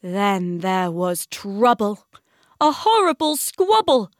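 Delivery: lively read-aloud of the kitchen squabble; excited story narration. A narrator's reading. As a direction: read the tumble with rising glee. Builds the kitchen fight.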